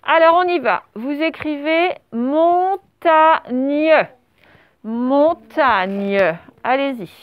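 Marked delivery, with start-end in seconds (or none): 2.1-4.1 MONTAGNE very slow, split mon, ta, gne; syllable-by-syllable dictation. Shown as a demonstration. Teacher dictates the last word to the class, dictation. 4.8-6.4 MONTAGNE lower, drawn out as mon, ta-gne; syllable-by-syllable dictation. Shown as a demonstration. Teacher repeats the word for pupils writing, dictation.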